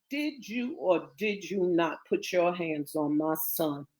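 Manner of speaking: low, quiet menace; menacing question. Acted. Mother confronting her brother about her son, quietly menacing. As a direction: demand an answer, low and soft.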